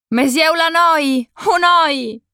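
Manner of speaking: high, slow, drawn-out call from far off; distant shout. A narrator's reading. Voice calling a girl's name across the bomb site, drawn-out shout. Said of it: "sentii gridare".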